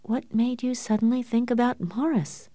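high, softened, frightened question; trembling voice. A narrator's reading. Frightened daughter questioning her father, trembling voice. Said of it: "asked in a trembling voice".

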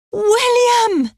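high, drawn-out cry that falls away. A narrator's reading. Anne recognises her long-lost brother at once, crying out. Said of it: "she cried".

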